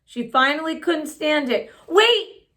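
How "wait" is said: higher, louder call; cry out. A narrator's reading. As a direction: call her back urgently. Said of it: "she cried".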